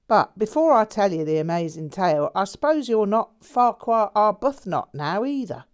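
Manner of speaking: a breezy lad spinning his tale; lightly voiced cheeky Londoner. A narrator's reading. Jack teasing his old friend, in a cheery Londoner voice. Jack's voice.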